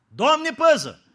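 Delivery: loud, high cry of alarm; alarmed exclamation. Acted. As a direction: cry out as if in danger. (exclamație alarmată, tare)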